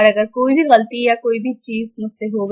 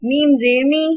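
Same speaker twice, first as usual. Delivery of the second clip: measured sing-song spelling, long held final syllables; Qaida spelling drill; 6 dB louder, much slower than usual. Shown as a demonstration. Teacher spelling tanween words aloud for children, sing-song. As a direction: chant each letter and sound like a reading drill.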